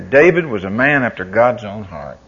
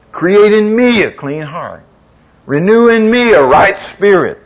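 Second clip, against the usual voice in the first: louder, higher preaching climb; full-force preaching; 8 semitones higher, 10 dB louder than usual. Real, not acted. Preacher building to David's prayer, loud and high. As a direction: preach it out with fire.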